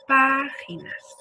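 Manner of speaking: páginas slowly, PÁ held long; slow demo, pá drawn out in páginas; stressed syllable stretched. Shown as a demonstration. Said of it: "Palabra esdrújula que se acentúa siempre".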